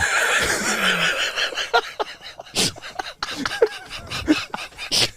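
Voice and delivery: very high voiceless laughter; long breathy cackle; long wheezing laugh. Real, not acted. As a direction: wheeze helplessly. Laughs after punchline.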